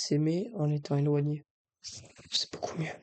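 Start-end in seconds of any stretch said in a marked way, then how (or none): 1.8-2.9 whispering. Real, not acted.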